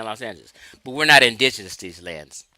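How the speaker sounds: ordinary delivery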